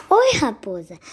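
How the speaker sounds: ordinary delivery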